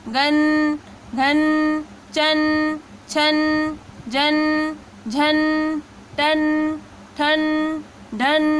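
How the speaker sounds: each syllable कन, खन, गन ending in a clear न, chanted one by one; syllable drill. Shown as a demonstration. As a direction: recite the अन drill steadily, pausing after each. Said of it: "अन का sound है". (अन ध्वनि का अभ्यास, हर अक्षर अलग-अलग साफ़)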